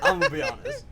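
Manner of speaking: high, squeaky words through a laugh; cracking up mid-sentence. Real, not acted. Laughing at being mistaken for guy.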